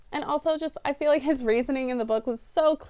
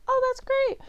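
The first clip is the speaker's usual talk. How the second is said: a much higher, chirpy mock girl voice; mocking Stella; 13 semitones higher than usual. Reviewer mocking Stella's bland movie reply, a high chirpy voice. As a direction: parody her empty cheerfulness.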